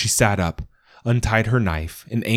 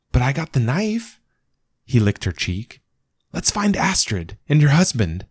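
eager little dog; 7 semitones higher than usual. Acted. Dog's ghost speaking.

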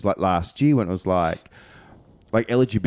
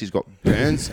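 normal talking voice

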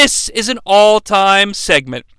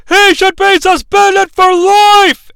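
high, slow, louder outraged yell; Gorilla yelling; 11 semitones higher, 5 dB louder, slower than usual. Retold or imitated. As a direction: bellow it like a shocked old commentator. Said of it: "Gorilla yells".

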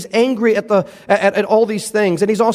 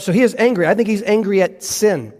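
usual speaking voice

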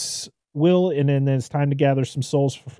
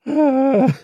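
high, loud, strained "Ah."; mock crazed cry; 13 semitones higher, 11 dB louder than usual. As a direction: yelp like a crewman losing his mind. Crazed crewman on wrecked ship.

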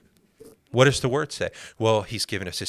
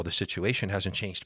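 ordinary delivery

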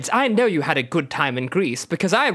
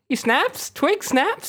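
incredulous; 10 semitones higher, slower than usual. Real, not acted. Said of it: "incredulous repeat".

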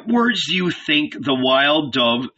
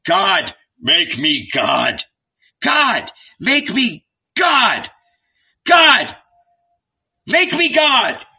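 put-on child's cry, much higher and louder, slow; God, make me God, shouted rising with each repeat; shouted prayer; 7 semitones higher, 3 dB louder, slower than usual. A narrator's reading. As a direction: cry out to heaven, demanding. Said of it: "shouted loudly".

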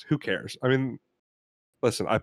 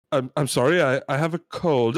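ordinary talk

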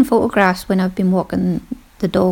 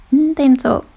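normal talking voice

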